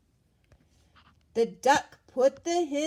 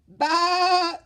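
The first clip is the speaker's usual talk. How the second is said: high, loud sheep bleat; bleating baa; 6 semitones higher, 10 dB louder than usual. A narrator's reading. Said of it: "said the sheep".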